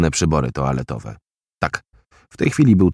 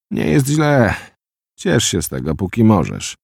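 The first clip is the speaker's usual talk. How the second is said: bitter smile; 5 semitones higher than usual. Acted. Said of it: "uśmiechnął się z goryczą".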